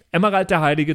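normal talking voice

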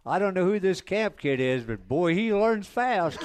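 announcer praising the new driver over the PA, mock announcer call. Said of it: "hear him say".

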